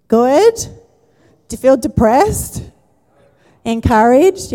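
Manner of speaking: high, loud questions tossed to the room; calling out to congregation. Real, not acted. Pastor quizzing the congregation, loud and high. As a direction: pull answers from the crowd.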